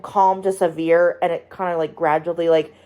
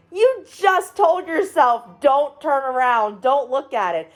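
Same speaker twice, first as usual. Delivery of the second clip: exasperated scolding; 6 semitones higher, slower than usual. Retold or imitated. Scolding the movie's heroine.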